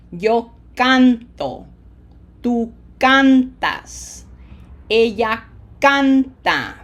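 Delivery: slow drill, CAN punched loud in canto, cantas, canta; pause, then hit CAN hard in canto, cantas, canta; exaggerated syllable stress. Shown as a demonstration. Teacher leading say it with me, slow with CAN heavily stressed. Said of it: "Feel the pronunciation emphasis".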